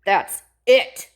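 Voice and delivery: loud, firm that's it; fed-up dad. A narrator's reading. Dad ending it with Ada, loud and firm. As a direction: end the matter, firmly. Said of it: "said her dad".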